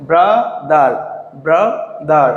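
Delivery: "Brother" in two separate beats, bra, dar; split into syllables. Shown as a demonstration. Teacher showing learners two syllables, "brother" split bra, dar. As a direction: break "brother" after bra with a clear pause. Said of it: "দুটি অংশ থাকে".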